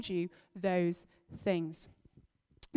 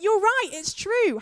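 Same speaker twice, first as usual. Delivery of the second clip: mock excited kid; 9 semitones higher, 5 dB louder than usual. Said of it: "I was like".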